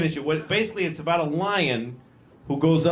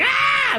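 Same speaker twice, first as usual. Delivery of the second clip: short, high, loud mock scream; terrified scream; 5 dB louder than usual. Lion recalls the dude always going like this, terrified scream. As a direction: burst into a piercing scream. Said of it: "that dude that's always going".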